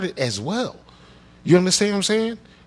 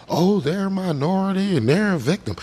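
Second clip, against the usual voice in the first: higher-pitched mocking singsong; mock-sympathetic refrain, pitched up; mocking parroting; 4 semitones higher than usual. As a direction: parrot a tired talking point in a high voice. Mimicking how politicians talk about victims.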